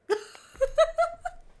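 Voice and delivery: high, loud burst of laughter. Real, not acted. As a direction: laugh at your own slip. Laughing at failed accent.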